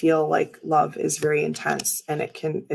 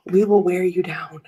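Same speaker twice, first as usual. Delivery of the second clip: mock menacing whisper; 3 dB louder than usual. Joking as scary children.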